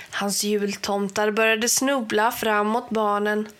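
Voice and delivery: ordinary delivery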